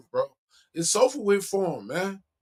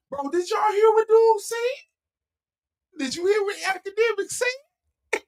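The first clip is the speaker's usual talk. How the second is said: excited disbelief; 14 semitones higher than usual. Real, not acted. Excited about dude's words.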